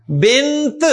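بنت closed on an audible t; final t sounded. Shown as a demonstration. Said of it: "تا کی آواز سنائی دے رہی ہے".

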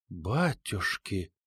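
very slow, hushed gasp of alarm; awed whisper. A narrator's reading. Лиза sees the bear at the window, whispering. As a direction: drop to a drawn-out whisper. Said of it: "прошептала Лиза".

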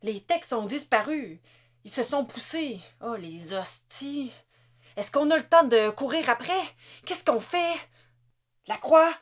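rising, anxious questions; frantic, asking the chief what now; panicked soldier. A narrator's reading. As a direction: soldier panicking about the techs.

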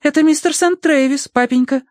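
put-on very high girlish voice; Тосси hastily interjecting. A narrator's reading. Daughter introduces a stranger to papa, hasty interjection. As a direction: pipe up before papa can go on. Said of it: "поспешно вставила Тосси".

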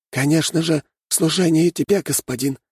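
high, devoted little rat voice; bow as you answer your master; polite rat servant. A narrator's reading. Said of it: "Снова вежливый кивок".

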